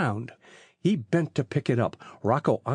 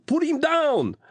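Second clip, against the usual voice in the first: put-on very high panicked Italian-accented cry; shriek; 13 semitones higher, 3 dB louder, slower than usual. A narrator's reading. Rocco begs Phil to drop the package, high shriek. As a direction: shriek the order in terror. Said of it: "he shrieked".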